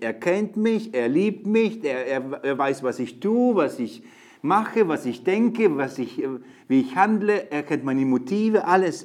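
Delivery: loud, high preaching on God knowing us; full-force sermon voice, high and loud; fiery preaching. Acted. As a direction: preach it out with passion to the youth.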